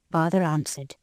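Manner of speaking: ordinary delivery, nothing marked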